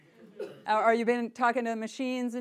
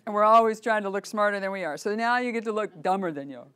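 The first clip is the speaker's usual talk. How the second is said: ordinary delivery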